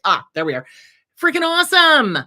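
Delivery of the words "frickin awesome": high, loud gush of praise; real excitement, much higher and louder; excited. Real, not acted. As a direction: burst out with delight. Enthusing about listener's book.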